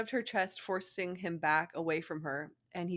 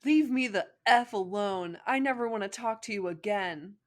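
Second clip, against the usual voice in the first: hammed-up anger; 4 semitones higher, 7 dB louder than usual. Angry girlfriend breakup lines.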